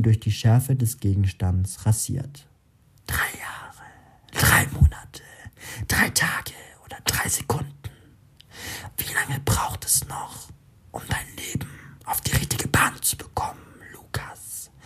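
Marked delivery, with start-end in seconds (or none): 3.1-14.7 whispered voice inside his head; whispery telepathic voice. A narrator's reading. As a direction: breathe it like a voice in his mind. Inner voice taunts him.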